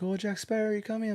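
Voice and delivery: high, eager hawker calling out; mock the sellers' pushy welcome; hammed-up street vendor greeting; market seller voice. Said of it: "it's like".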